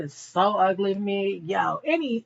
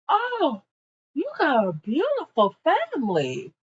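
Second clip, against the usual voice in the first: high, sugary mock compliment to the boss; butter him up in a high put-on voice; flirty fake compliment; 6 semitones higher than usual. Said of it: "Matipa's like".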